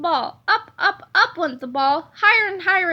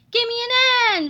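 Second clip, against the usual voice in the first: cheerleader shout; 7 semitones higher, 4 dB louder than usual. Acted. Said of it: "shouted the cheerleaders".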